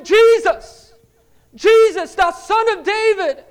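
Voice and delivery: loud, high, piercing cry; screaming. Shown as a demonstration. Preacher showing the blind men's desperate scream to Jesus. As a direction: belt out an urgent plea from the depths. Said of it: "But they were screaming".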